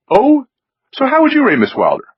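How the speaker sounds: high, brisk snobbish query; haughty challenge. A narrator's reading. Wynn challenging Myron's rating of Allie, haughty and high. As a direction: toss it back with arch surprise.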